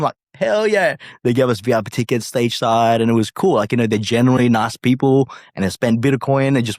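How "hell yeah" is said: much higher, whooping hell yeah; mock thrilled yes; excited. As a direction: yell it with glee. Excited acceptance in story.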